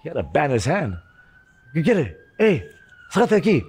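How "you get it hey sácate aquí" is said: higher-pitched, rapid-fire challenge; acted confrontation. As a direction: re-enact shooing someone away. Acting out the confrontation outside.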